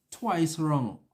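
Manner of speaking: ordinary delivery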